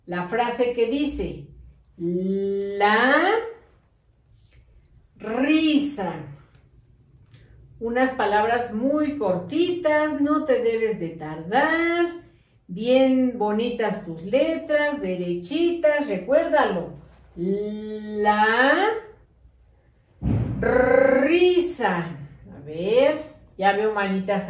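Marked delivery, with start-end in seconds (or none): 2.0-6.1 La, a long pause, then risa; dictation with a long pause. Shown as a demonstration. Teacher dictating to pupils, pausing between words. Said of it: "hago la pausa". 17.4-22.2 La, a long pause, then risa; dictation with a long pause. Shown as a demonstration. Teacher dictating to pupils, pausing between words. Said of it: "hago la pausa".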